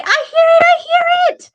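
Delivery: very high, loud, gleeful squeal; excited child's cry. Pretend. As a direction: pipe up like a thrilled pupil. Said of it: "I want you to say".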